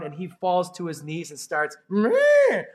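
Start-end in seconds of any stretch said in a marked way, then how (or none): 1.9-2.6 a mock animal bleat. The fallen king bleats like an animal. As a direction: bleat like a beast in the field. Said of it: "like some sort of animal".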